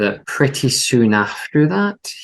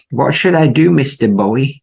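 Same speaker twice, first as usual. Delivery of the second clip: high eager bellhop voice, louder; chirpy old-fashioned bellhop; 5 semitones higher, 5 dB louder than usual. Retold or imitated. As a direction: play the keen hotel bellhop. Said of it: "says the bellhop".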